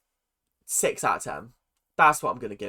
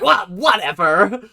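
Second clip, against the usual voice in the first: dismissive; 3 semitones higher, 8 dB louder, slower than usual. Retold or imitated. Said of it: "Melissa's like".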